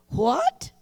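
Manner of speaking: high incredulous What?; shocked disbelief. Retold or imitated. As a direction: snap up in pitch, stunned. Husband sides with doctor.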